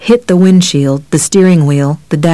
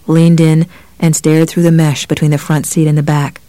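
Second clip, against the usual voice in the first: usual speaking voice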